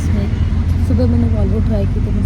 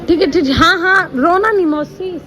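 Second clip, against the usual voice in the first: higher, soothing yes-yes; comforting aunt on departing train, higher voice; soothing high reassurance; 7 semitones higher than usual. Real, not acted. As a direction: reassure her gently, lifting the pitch.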